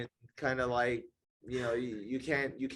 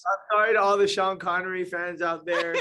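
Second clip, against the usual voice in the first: laughing apology; 5 semitones higher, 6 dB louder than usual. Real, not acted. Apologizing after a gaffe.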